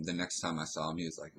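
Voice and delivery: ordinary talk, nothing marked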